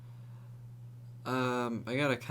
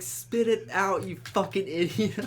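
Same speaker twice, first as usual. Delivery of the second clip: mock yell; 7 semitones higher, 4 dB louder than usual. Yelling at tripping friend.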